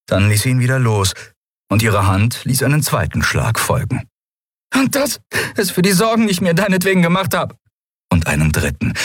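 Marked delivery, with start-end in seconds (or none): 4.7-7.6 high, scolding woman's voice; a heroine scolding between slaps; Endriel's voice. Acted. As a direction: raise the pitch and scold him half-laughing. Endriel scolds Kai while slapping.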